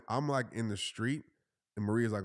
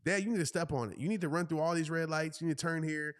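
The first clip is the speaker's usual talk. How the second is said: higher imitated kid giving orders; bossy daughter; 6 semitones higher than usual. Daughter directing dad's driving, imitated bossiness. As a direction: lightly mimic a bossy child backseat-driving. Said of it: "Then Maya is bossy".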